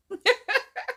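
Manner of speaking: short high-pitched giggle; high laugh. Real, not acted. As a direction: burst into a giggle. Laugh after line.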